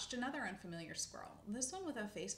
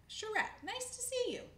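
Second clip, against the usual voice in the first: high, loud show of recognition; effusive greeting; 14 semitones higher, 6 dB louder than usual. Acted. Old man who won't admit forgetting her, effusive greeting. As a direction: greet her as if you remembered her.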